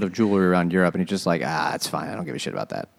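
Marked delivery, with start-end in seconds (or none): 1.4-2.9 dropping low and soft into a shrug; sinking lower and softer, utterly unbothered; couldn't care less. Retold or imitated. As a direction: mumble it like it's nothing at all.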